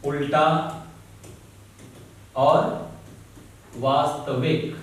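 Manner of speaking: each word set apart by long pauses; slow, spaced dictation to students. Shown as a demonstration. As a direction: dictate it slowly for notes, word by word.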